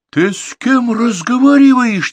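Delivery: slow, very loud and high; furious shout. Acted. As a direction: roar the question at him. Furious boss confronting writer.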